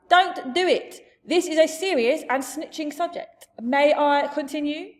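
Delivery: loud, high giant scolding; exasperated giant. Acted. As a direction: keep shouting as the cross giant. Giant continues shouted scolding.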